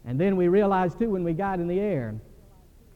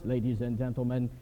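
put-on Scandinavian Schwarzenegger accent; 6 semitones lower than usual. Pilot announcing to passengers to deplane, in a light mock Scandinavian accent. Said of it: "in that Scandinavian Arnold Schwarzenegger voice".

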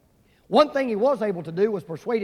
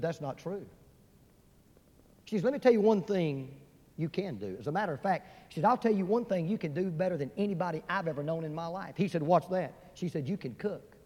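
tender wife; 2 semitones lower, 7 dB quieter than usual. Acted. Wife tenderly encourages husband.

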